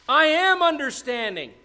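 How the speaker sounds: very high, loud proclamation; shouting out. Acted. Wisdom presenting herself to the street, shouting out. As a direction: cry it out as a public boast. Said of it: "I'm shouting out".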